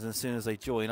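usual speaking voice

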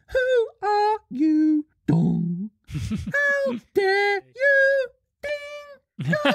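very high, slow, sing-song Tinker Bell with voice breaks; a tiny fairy squealing in singsong outrage; sky-high, sung and cracking fairy squeal; squeaky fairy voice. Acted. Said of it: "I do enjoy the voice breaks".

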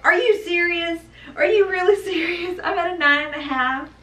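can't believe the good news; stunned disbelief. Retold or imitated. Mother hearing she's nearly ready, shrieking with surprised joy.

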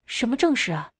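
a touch of bewilderment; puzzled. A narrator's reading. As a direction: ask it in mild confusion. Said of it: "迷惑道".

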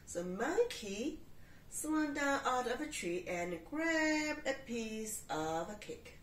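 usual speaking voice

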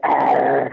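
a short hammed-up pirate growl. Showing the prince the pirate call, a short hammed-up growl. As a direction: bark a quick pirate ah. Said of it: "And I went".

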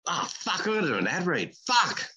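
hammed-up dread at an ad read; mock despair. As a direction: grumble like the day is ruined. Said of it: "This is the worst day of my life".

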